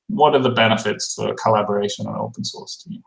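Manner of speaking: ordinary talk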